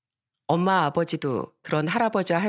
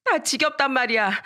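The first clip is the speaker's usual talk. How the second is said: put-on higher-pitched child's fed-up snapping; resentful outburst; 5 semitones higher than usual. Daughter brushes off her mother's apology, high resentful protest. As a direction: reject the apology bitterly. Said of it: "엄마를 원망하며 말했어요".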